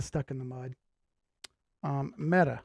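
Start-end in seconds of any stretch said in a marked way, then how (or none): none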